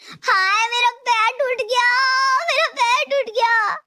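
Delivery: high, wailing fake pain over a pretend broken leg; daughter-in-law faking an injury, crying out high; fake cries of pain. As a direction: ham up the fall, crying out high. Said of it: "जोर-जोर से चिल्लाने लगती है".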